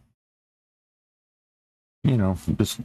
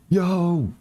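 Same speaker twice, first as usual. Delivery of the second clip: sharp call out; 11 semitones higher than usual. Retold or imitated. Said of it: "and he went".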